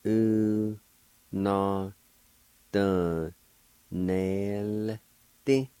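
U, na, dun, nel, Ti, each held alone; long pauses between drawn-out syllables; slow teaching demo; syllable-by-syllable pronunciation. Shown as a demonstration. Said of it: "pronounce the L sound with the N E like this".

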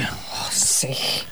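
a soft unvoiced mock protest; whispered aside. Real, not acted. Colleague brushing off his teasing, soft whisper. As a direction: breathe it out under your breath.

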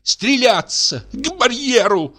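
indignant shouts of a widow at a suitor. Acted. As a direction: challenge him to a duel in fury. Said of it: "возмущённая, потрясает револьвером".

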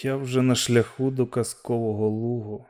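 slipping toward unconsciousness; weak farewell to Jan; fading away. Acted. Fading thought toward fainting.

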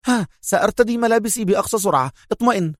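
high, quick, perky reassurance; spring to life all at once; brisk and wide awake; suddenly energetic. A narrator's reading. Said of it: "بدا وكأنها قد استعادت نشاطها كله دفعة واحدة".

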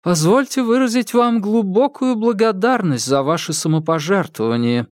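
official speaking behind a mask; muffled through mask. Acted. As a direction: dull voice through a face mask. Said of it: "Сквозь маску голос звучал глухо".

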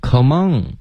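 "Come on" ending in a back ng sound; back nasal error. Shown as a demonstration. Teacher copying the student's mistake, back nasal ending. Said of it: "然后mon是一个后鼻音".

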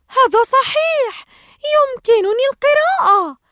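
excited realization. Acted. Dory remembers she can read and shouts with joy. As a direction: burst out as it dawns on you. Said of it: "ثم تذكرت وصاحت".

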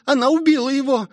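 put-on high, hurried girl's voice; frantic high exclamation; panicked cry. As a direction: rush it out in high alarm. Rosie panics after the killing.

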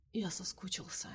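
soft breathy whisper to his captor; barely voiced, intimate longing. A narrator's reading. Prisoner whispering to the woman he wants back, hushed. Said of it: "прошептал пленник".

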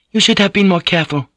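high, fast self-scolding; a woman berating herself, quick and high. A narrator's reading. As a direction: chide yourself under your breath. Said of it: "she told herself".

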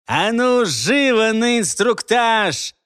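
loud, slow barked order; sadistic bellow. A narrator's reading. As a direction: bark it out like a cruel drill officer. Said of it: "проорал он почти садистским голосом".

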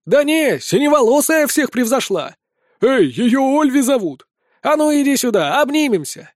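high, boisterous calls; excited crowd shouts. A narrator's reading. Spectators arguing over the best dancer, excited shouting.

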